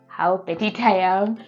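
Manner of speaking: ordinary delivery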